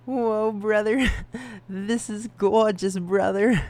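loud, high, awed bro voice; amazed bro voice. Pretend. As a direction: gush like a stunned dude. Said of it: "You're like".

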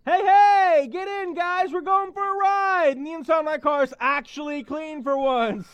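loud, high, thrilled hello from the car; bursting with excitement to pick them up; excited greeting. Acted. Driver calling her friends into the car, thrilled and loud.

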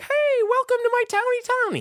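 very high squeaky welcome; cheery squeaky little man in a balloon; tiny character voice. Retold or imitated. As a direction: chirp it like a tiny cartoon host. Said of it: "He's like".

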